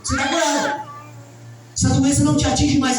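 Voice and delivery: acted faith-healer cry; healing proclamation. Acts someone proclaiming a healing.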